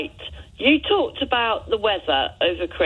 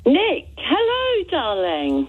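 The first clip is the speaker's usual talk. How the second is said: gushing greeting; 7 semitones higher, slower than usual. Real, not acted. Caller greets very high.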